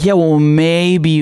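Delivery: slow, noncommittal mock shrug; mock hesitation. Mocking voters who are wavering, slow mock hesitation. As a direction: drag it out like a reluctant voter. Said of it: "now they're like".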